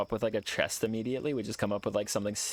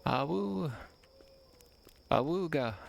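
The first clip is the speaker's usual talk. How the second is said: howling like a wolf pup; 5 semitones higher, slower than usual. Acted. Playful yelps.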